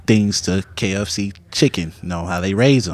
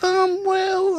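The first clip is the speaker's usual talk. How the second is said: hesitant mom voice. Retold or imitated. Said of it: "She said".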